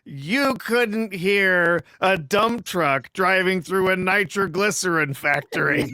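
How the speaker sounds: slow, deliberate movie-line delivery; stretched-out deadpan put-down; quoted wisecrack. Retold or imitated. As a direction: draw the insult out word by word.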